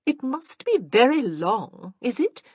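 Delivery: a lightly voiced fretful aunt; fussy aunt. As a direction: peer at the hair with distaste. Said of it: "Aunt Mildred".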